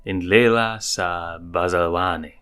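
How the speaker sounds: ordinary delivery, nothing marked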